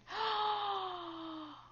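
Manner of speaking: shocked gasp. Acted. Discovers money gone.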